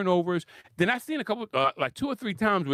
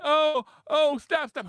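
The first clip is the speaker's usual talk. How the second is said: higher mock whining; mock whine; 8 semitones higher, slower than usual. Commentator imitating Kate whining, higher mock whine. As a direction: whine like a complaining player. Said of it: "All she had to do was whine".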